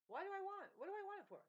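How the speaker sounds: high, loud incredulous questions; exasperated. Real, not acted. Asking why money matters, high and incredulous. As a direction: throw your hands up in disbelief.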